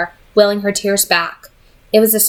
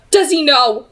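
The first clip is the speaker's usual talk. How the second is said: demanding; 6 semitones higher than usual. A narrator's reading. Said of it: "he demanded".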